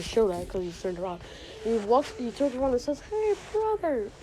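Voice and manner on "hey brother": higher, slow, uneasy put-on greeting; a traitor caught off guard, trying to sound calm; nervous tone. Betrayer turns to face his wronged brother, nervous. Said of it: "in a nervous tone".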